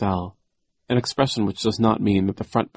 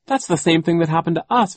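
high, loud upset outburst; cried out; 9 semitones higher, 5 dB louder than usual. A narrator's reading. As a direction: Violet cries out, pitched up. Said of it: "Violet cried".

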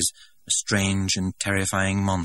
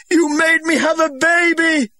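much higher, louder, slow scream; 10 dB louder than usual. A narrator's reading. Linda screaming at the director above the uproar. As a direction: scream it over the crowd. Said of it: "she screamed above the uproar".

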